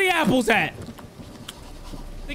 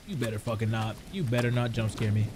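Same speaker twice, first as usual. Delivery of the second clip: nervous hushed warning; 10 semitones lower, 7 dB quieter, faster than usual. Real, not acted. Dreading the next jump scare.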